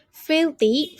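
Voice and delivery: FIL-thy, first syllable high and loud; stress on the first syllable. Shown as a demonstration. Teacher announcing the new word Filthy to students, emphatic. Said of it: "put the stress on the first one".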